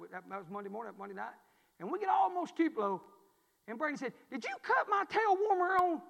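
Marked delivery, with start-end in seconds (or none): 4.3-6.0 high, loud, puzzled mimic of Brandy; lightly voiced Brandy, accusing; Brandy's question. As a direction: play Brandy's sudden suspicion. Brandy asks about the seat heater.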